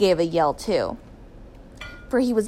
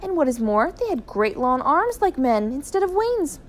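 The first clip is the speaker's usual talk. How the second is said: usual speaking voice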